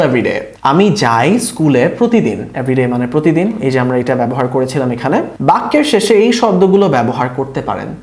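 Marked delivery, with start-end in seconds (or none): none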